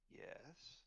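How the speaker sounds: very soft, aloof questioning "yes"; quiet, drawn-up query; imperious dog. Acted. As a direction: answer softly as a haughty wolf-sized dog. Dog answers mind to mind.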